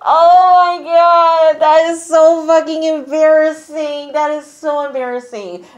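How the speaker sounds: high, long-drawn embarrassed wail; cringing hilarity. Real, not acted. Host reacting to the prank-like painting, wailing with secondhand embarrassment. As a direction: wail it slowly, mortified on their behalf.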